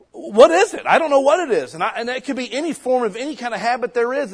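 loud, high, insistent sermon; preaching at full force. Acted. Pastor presses the congregation about habits, loud and high. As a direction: hammer the question home.

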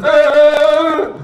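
loud, high, drawn-out mock laugh. God comes down to answer the mockers, mocking laugh. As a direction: ridicule them with a big put-on laugh. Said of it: "it's this kind of laugh".

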